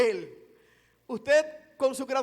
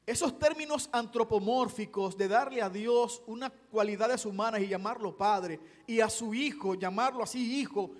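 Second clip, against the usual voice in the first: ordinary delivery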